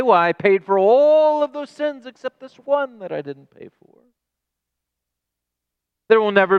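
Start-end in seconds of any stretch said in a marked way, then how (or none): 0.0-4.0 raised pitch, hypothetical speaker voicing an absurd claim; mock quote of Christ. Pastor voicing what Christ will never say, lightly mocking. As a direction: voice the impossible excuse, lightly ironic. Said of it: "There's never a day he will say".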